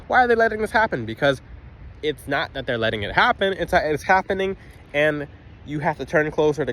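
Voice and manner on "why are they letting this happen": higher-pitched, lightly acted complaint; imitated unhappy questioner. Person feeling let down by their angels, imitated complaint. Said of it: "you're like".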